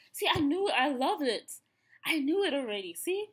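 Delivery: high and loud, triumphant glee; real burst of joy at being right; excited. Real, not acted. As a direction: crow it out, you called it.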